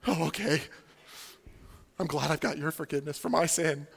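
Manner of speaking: low, hesitant, puzzled reply; awkward acceptance. Acted. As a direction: play along uneasily, a bit quietly. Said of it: "That'd be weird".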